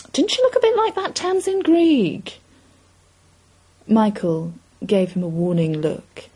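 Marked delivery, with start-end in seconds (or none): 0.1-2.3 put-on high-pitched gossiping old pub regular; old man. A narrator's reading. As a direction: pitch it high, remarking to a crony. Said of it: "One of the old men shook his head and said".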